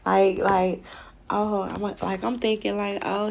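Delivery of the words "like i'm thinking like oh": ordinary talk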